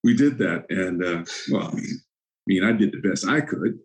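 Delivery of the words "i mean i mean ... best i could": usual speaking voice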